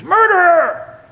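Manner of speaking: high, loud imitated scream; drawn-out high shriek, mimicking a protester; screamed accusation. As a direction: yell it out like a heckler. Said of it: "somebody screams".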